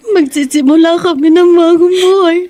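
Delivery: loud, high sobbing cry; breaking down crying. Acted. Son sobbing to his friend about losing his father, crying loudly. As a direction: voice cracking into tears over his father.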